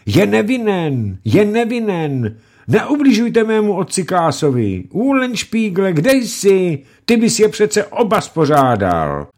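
high, loud desperate screaming; screaming and crying. Acted. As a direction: scream for your father's life. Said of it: "dala do křiku a pláče".

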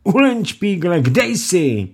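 high, loud calling for help; mother calling her son as bailiffs attack; desperate call. Acted.

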